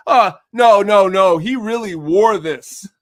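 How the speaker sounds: loud, high-pitched protesting customer; worked-up customer, acted out; insistent protest. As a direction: protest loudly that it's genuine. Acting out insistent pawn-shop customer.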